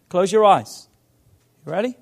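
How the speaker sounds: normal talking voice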